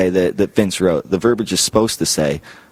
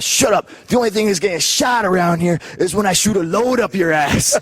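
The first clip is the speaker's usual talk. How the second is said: much higher, yelled fake-tough promo line; Steiner shouting; 9 semitones higher than usual. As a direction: bark it at him like a mad brawler. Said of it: "he tells Borash".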